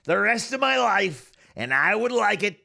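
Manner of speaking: high-pitched presidential impression; Donald Trump. Streamer vowing to talk so forever, Trump impression. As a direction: parody voice held in full swing. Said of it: "just talk like this".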